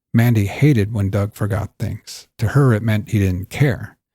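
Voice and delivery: ordinary delivery, nothing marked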